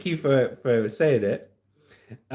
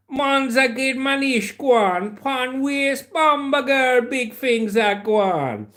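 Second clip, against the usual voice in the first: higher, drawn-out patois; Jamaican patois accent; 9 semitones higher, much slower than usual. Shown as a demonstration. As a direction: put on a mock Jamaican patois. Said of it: "Here's a bit of patois for you".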